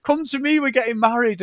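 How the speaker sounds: high shouted yeah, then settling; hammed-up giddy acceptance; mock eager. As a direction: gush like a sudden bride. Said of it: "she's like".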